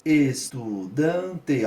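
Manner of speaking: "Estudante" drawn out slowly, one syllable at a time; es-tu-dan-te spelled out slowly by syllable. Shown as a demonstration. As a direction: count out each beat of "Estudante" slowly. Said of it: "ó, quatro sílabas".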